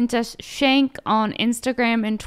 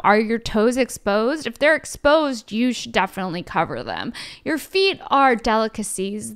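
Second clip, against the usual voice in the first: ordinary talk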